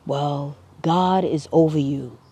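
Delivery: ordinary talk, nothing marked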